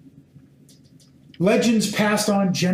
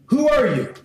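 higher, pressing question; priests demanding; 6 semitones higher than usual. Acted. Priests from Jerusalem question John, pressing. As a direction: press him for an answer.

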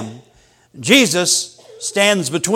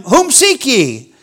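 slow commanding question; 5 semitones higher, slower than usual. Acted. Voicing Jesus asking again.

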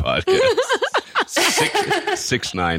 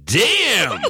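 stunned exclamation; 7 semitones higher than usual. Retold or imitated. Man reacting to the thing pulled from the finger, stunned. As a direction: blurt it in amazement. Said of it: "I was like".

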